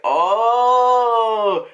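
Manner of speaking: long, loud, high "ohhh"; loud drawn-out sound of understanding; realization "oh". Retold or imitated. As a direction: stretch a loud oh as it clicks. Teller acts out things clicking.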